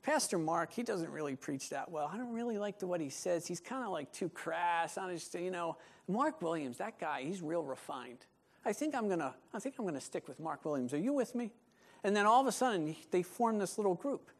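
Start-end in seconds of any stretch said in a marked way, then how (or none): 0.0-11.5 hushed, gossipy mock complaint; gossipy church member. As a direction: murmur it like a disgruntled churchgoer plotting.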